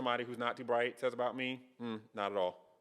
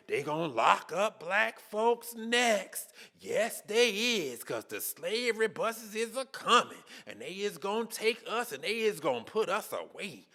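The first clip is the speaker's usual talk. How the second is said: slow, higher mocking drawl; 5 semitones higher, slower than usual. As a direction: ham up a paranoid rant, slowly. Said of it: "They gotta say".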